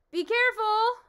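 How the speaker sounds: loud, high cry of warning; warning shout. Acted. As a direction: shout to stop the fight. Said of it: "shouted Milo".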